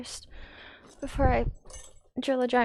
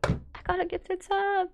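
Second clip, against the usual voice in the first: sleepy excited viewer; 8 semitones higher, 4 dB quieter than usual. Retold or imitated. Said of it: "Someone's gonna wake up tomorrow like".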